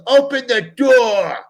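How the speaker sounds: slow shouted father's command; retold angry demand. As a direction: bark it through the door. Dad demanding, building anger.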